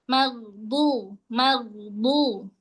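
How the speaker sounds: maghdu with gh drawn out before du; slow, sustained maghdu; held ghain in maghdu. Shown as a demonstration. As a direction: linger on the gh, then sound du. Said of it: "tahan nih sampai bunyi du".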